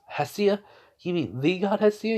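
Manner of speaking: high, wide-eyed disbelieving question; Elaine, astonished. Acted. Elaine hears the goddess's name and asks, astonished. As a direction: lift the pitch in startled recognition. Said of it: "Elaine's eyes widen".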